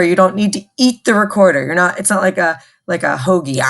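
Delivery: fast, playful warning; teacher joking about eating the recorder; quick joking aside. Shown as a demonstration. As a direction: rattle it off as a joke. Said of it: "joking rushed warning".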